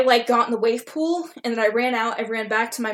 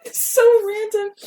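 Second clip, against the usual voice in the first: laughing; 12 semitones higher, 5 dB louder, slower than usual. Real, not acted. Laughing aside at own story.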